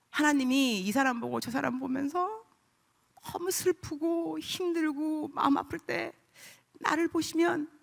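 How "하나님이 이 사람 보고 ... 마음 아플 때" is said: usual speaking voice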